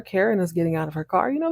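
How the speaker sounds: ordinary delivery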